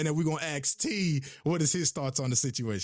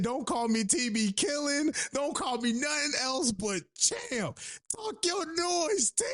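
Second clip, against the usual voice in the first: Champ's voice; 8 semitones higher, slower than usual. Retold or imitated. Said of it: "that man said".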